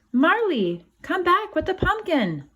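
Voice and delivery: high, loud scolding call. Acted. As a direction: call the runaway dog back sharply. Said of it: "Mommy scolded".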